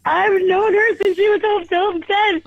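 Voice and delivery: high-pitched, gushing excitement; can't calm down, voice riding high; excited. Real, not acted. Giddy fan bragging how long she's known her, excited. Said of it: "I was trying to calm down, but I couldn't".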